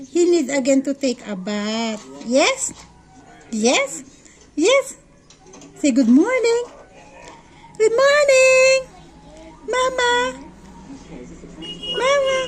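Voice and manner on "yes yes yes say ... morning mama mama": high, sing-song coaxing for a little one. Real, not acted. Mom coaxes her toddler to say good morning, high sing-song.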